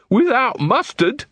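slow, high-pitched disbelief; appalled. Acted. Colon on hearing of forced sausage eating, appalled. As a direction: draw it out in mock horror.

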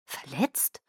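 softly baffled, questioning echo. A narrator's reading. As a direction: repeat it quietly, low and puzzled. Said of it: "wiederholte ich verblüfft".

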